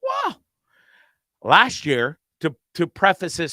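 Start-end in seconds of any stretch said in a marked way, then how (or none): 0.0-0.4 high, breezy "oh, a snake, wow" as if it's nothing; mock nonchalant. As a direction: play it cool but way up high. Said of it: "I was just like".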